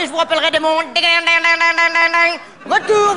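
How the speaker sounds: high, squeaky clowning greeting with pouet noises; silly high answering-machine voice. Shown as a demonstration. Recording a silly voicemail greeting in a squeaky voice. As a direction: goof around in a high cartoon voice. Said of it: "je vais faire un truc plus rigolo".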